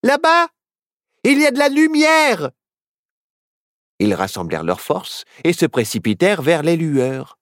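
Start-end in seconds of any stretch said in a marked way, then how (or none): 0.0-2.5 high, loud cry of hope; exclaiming. Acted. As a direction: point out the light. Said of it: "quand Nao s'écria". (Nao s'écrie, plein d'espoir)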